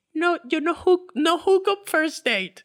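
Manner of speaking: mock girly voice of a picky match; mocking the girl. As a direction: mimic the prim date refusing. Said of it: "esa jeva que a la vez dice".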